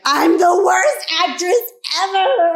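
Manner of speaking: high, loud, slow, wailing self-pity; hammed-up tearful lament; mock despair. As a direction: wail it like a crushed beginner. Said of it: "I cried".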